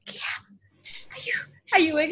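whispered name, then a soft voiced question; tentative quiet call. Second try waking sleeping Guillaume, hushed then voiced. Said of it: "but you don't really wanna wake them up".

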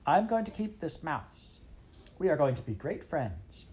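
a princess who gets her way; a lightly voiced young princess. Princess claims the mouse.

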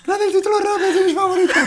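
put-on high laughing falsetto girl voice. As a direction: try a girly voice and crack up. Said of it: "poner voz de chica".

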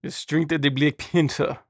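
badly faked African movie accent; terrible African accent. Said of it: "that was a terrible accent".

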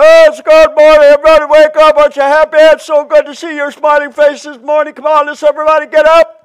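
loud, high, fast fake-cheerful wake-up call; hammed-up insincere greeting shouted high and fast; mock cheery yelling. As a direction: holler a phony good morning at the whole room. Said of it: "you're going around yelling at everybody".

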